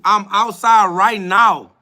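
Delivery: heated, drawn-out dare; slow defiant challenge. Real, not acted. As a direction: tell him you're ready, word by word. Challenging a troll.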